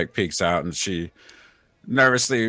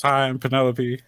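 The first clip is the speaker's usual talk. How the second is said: put-on higher, eager little girl greeting; super excited; 5 semitones higher than usual. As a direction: greet new heroes with a child's excitement. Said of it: "she's super excited and, and, and waves".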